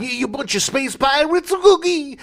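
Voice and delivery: high, loud hammed Brooklyn hero; Brooklyn accent. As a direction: shout it like a Brooklyn tough guy. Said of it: "he didn't sound like this".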